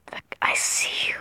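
hushed whisper to the camera. Pretend. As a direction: whisper it, sneaky. Whispering to someone.

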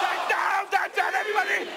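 very high shout over crowd noise; calling out. Real, not acted. Presenter calling to everybody during noise contest, high shout. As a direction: shout high to a whole roaring room.

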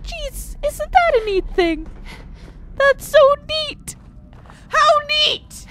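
high, overdone gushing; mock amazement; sarcastic awe. Real, not acted. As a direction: fake delight through clenched frustration. Sarcastic gushing over enemy armor.

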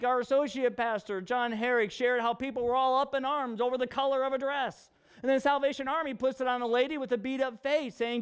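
normal talking voice

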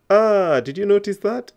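high, loud burst of discovery; excited aha. Real, not acted. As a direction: exclaim it to make learners notice. Teacher springs a catch on learners.

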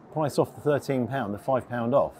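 usual speaking voice

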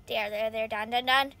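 usual speaking voice

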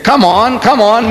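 impatient urging. Retold or imitated. Reverend beats his feet awaiting the answer, impatient. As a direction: hurry him along for an answer.

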